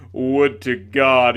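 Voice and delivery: "God" drawn out as Gawd, loud and slow. Acted. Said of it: "pronouncing it God".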